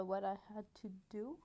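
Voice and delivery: English phrase in a French accent. Shown as a demonstration. Non-bilingual vlogger reading English, French accent. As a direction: read it carefully with a French accent. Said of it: "observez mon bel accent anglais".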